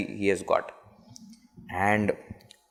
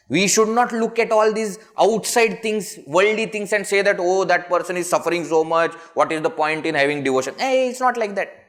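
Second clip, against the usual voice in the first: impassioned preaching; 7 semitones higher, 4 dB louder than usual. Acted. Preaching at full force.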